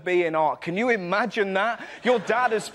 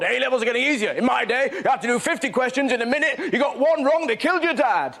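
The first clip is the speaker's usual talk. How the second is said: louder, higher mock grumbling; grumpy old-timer; 5 semitones higher, 3 dB louder than usual. Comic mocking older people complaining about exams, loud ranting grump. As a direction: rant like a bitter old man. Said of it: "How do we respond?".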